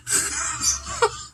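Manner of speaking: a high burst of laughter. Real, not acted. Laughing high at the memory of the fight. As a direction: let a high laugh break out.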